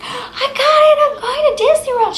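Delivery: very high, loud squeal with a laugh; excited shriek. Retold or imitated. Telling mom she got into Disney, a high laughing squeal. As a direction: burst out with the news, laughing.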